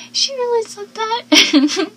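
high, slow, squeaky in disbelief. Retold or imitated. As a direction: act stunned that anyone would say that. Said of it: "'cause I'm like".

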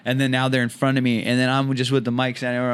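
usual speaking voice

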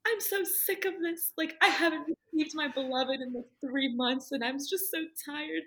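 high, strained voice of being fed up; weary complaint. Retold or imitated. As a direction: whine it out, worn down and near tears. Retold exhausted complaint to priest.

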